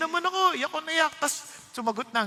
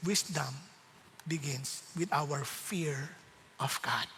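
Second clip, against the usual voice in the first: slow near-whisper; hushed; 6 semitones lower, 9 dB quieter, slower than usual. Real, not acted. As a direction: breathe it out reverently. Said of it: "hushed maxim".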